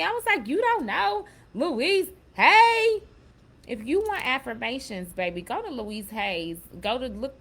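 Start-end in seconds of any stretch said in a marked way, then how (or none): 0.5-3.0 loud, high, drawn-out incredulous question; retold disbelief. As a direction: exclaim it slowly in hammed-up astonishment. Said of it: "that was so wild to me".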